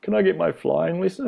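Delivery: high-pitched imitation of himself as a kid; young kid. Grown man recalling his childhood request at the airport, high kid voice. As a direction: pipe up like an eager kid asking. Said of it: "when I was young and being like".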